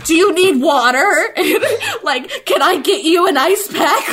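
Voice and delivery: frantic offer. Retold or imitated. Rushing to the crying girl, a high frantic laughing offer of help. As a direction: fuss over her like a panicked helper. I was freaking out.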